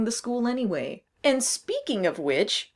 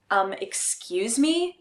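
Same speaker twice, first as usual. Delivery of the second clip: usual speaking voice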